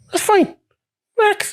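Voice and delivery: high, lightly mocking soothing voice; imitating the calm lorry owner on the phone; mock soothing boss. Voicing lorry owner calming him.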